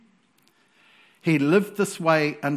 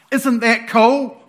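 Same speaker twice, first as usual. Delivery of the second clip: louder, slower and higher; excited; 5 semitones higher, 6 dB louder, slower than usual. Real, not acted. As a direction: really sell how cool it is.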